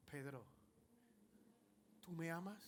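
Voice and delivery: soft, low and gentle; the Lord asking Peter softly, tender; tender question. Acted. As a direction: ask it lovingly, no reproach at all.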